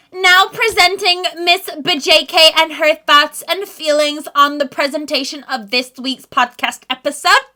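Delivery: loud, high showman intro; grand presenter. Acted.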